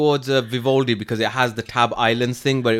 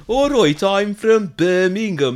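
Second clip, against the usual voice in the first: slow, higher, mock Birmingham drawl; Birmingham accent; 4 semitones higher, 3 dB louder than usual. Shown as a demonstration. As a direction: put on a dopey Birmingham accent, louder. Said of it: "I sound like I'm from Birmingham".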